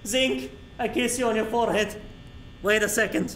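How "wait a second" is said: normal talking voice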